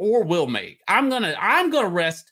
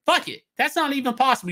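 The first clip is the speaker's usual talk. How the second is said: giddy self-mockery; 9 semitones higher, faster than usual. Real, not acted. Laughing at own exaggeration.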